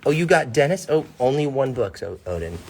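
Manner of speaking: ordinary delivery, nothing marked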